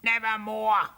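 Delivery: put-on talking-bird voice; doubtful raven. A narrator's reading. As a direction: squawk it with a touch of doubt. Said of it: "muttered Mortimer, a little doubtfully".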